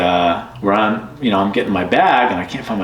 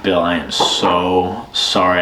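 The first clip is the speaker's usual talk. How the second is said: retold apology; 3 semitones lower, slower than usual. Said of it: "I'm like".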